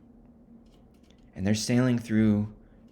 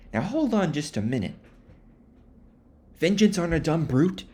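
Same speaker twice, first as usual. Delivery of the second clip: higher, incredulous objecting; indignant Starbuck; 6 semitones higher than usual. Retold or imitated. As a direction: argue he's gone mad, exasperated. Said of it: "Starbuck is like".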